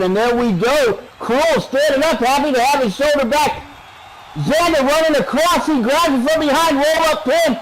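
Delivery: high, driving call of a pin attempt; live play-by-play. Real, not acted. Announcer calling a roll-up pin for viewers, excited play-by-play. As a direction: rise into excited commentary, then ease off.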